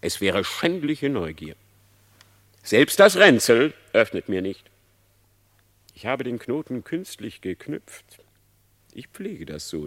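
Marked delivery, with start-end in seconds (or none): 2.7-4.7 stern warning. Acted. Reineke warnt eindringlich.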